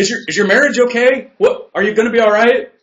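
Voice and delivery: loud, high mock-panicked family; mock worried shout. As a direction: yell it like a worried relative. Family worried about midlife crisis.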